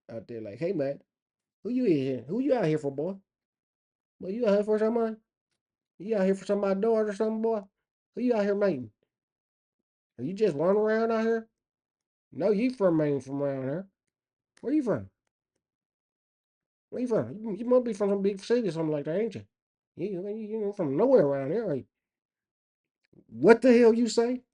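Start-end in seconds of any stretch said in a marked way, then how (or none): 0.6-21.8 suspicious country-hillbilly interrogation; mock hillbilly. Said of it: "this hillbilly is saying". 23.3-24.4 high, loud, incredulous comeback; mock-bewildered and loud, pitched way up; baffled retort. Baffled reply in hillbilly bit.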